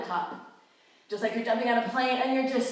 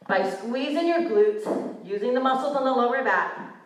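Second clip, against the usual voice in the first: ordinary delivery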